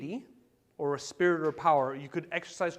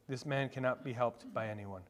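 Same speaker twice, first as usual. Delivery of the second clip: low, soft and grave; hushed repeat; 5 semitones lower, 9 dB quieter than usual. Real, not acted. Preacher repeating the hopeless verdict to the congregation, hushed. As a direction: drop the voice and let it sink in.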